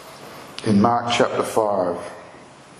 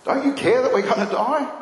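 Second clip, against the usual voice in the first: high-pitched reproachful plea; acted-out accusation, pitch jumping up; accusing; 10 semitones higher than usual. Disciples waking Jesus in the storm, accusing. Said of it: "accuse him of not caring".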